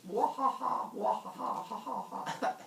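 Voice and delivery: high mock evil laugh, fading away; hammed-up cackle that trails off; villainous giggle. Teller as Hera slipping away after her curse, mock evil giggle. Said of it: "giggling again".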